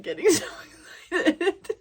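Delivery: words break up into laughter, rising high; excited laughing. Real, not acted. Vlogger confessing to viewers they seem crazy, excited laughing. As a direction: giggle helplessly through the words.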